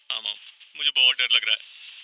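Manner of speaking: loud, whimpering on the edge of crying; tearful voice. A narrator's reading. Tanya telling mom she is scared, tearful. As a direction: confess fear through tears. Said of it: "रुआंसी आवाज में कहा".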